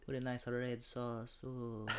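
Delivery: put-on accent, savoring each word; hammy food pusher. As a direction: tempt him in a caricature voice. Same put-on food character.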